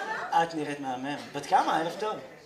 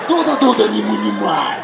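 אמא רוסייה כועסת; 3 dB louder than usual. Acted. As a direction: bark gibberish like an angry Russian mum. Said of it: "אבל יש שם כעס". (אמא רוסייה נוזפת בקול רם)

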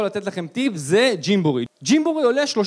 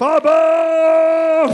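put-on long, drawn-out high child's call, louder; toddler shouting dad; 7 semitones higher, 7 dB louder, much slower than usual. Toddler with a hernia calling for his dad, drawn-out shout. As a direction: stretch the call like a little kid hollering.

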